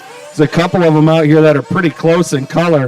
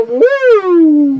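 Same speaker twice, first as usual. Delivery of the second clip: excited woo; 13 semitones higher than usual. Real, not acted. Announcer hypes a Marshall.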